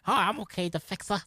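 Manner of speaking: high, mock-sweet fixer voice; lilting parody of a people-pleaser. Mocking the fake-nice fixer type, high and sweet. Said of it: "One of those".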